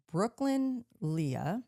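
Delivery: ordinary talk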